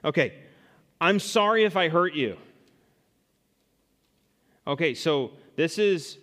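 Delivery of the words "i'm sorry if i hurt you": fake apology. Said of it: "token statements that are not confession".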